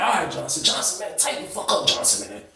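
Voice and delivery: soft, half-whispered warning; hushed urging. Retold or imitated. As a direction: urge him under your breath to shape up. Urgent quiet plea to cellmate.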